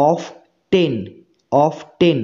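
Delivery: অফ টেন with the t sounded; wrong pronunciation. Shown as a demonstration. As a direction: split অফ টেন into off and ten, t fully sounded. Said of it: "এইভাবে উচ্চারণ করলে উচ্চারণটা ভুল হবে".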